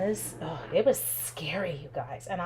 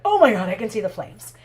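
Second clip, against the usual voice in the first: freaking out; 8 dB louder than usual. Retold or imitated. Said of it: "I'm freaking out".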